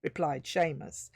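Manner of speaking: plain reading voice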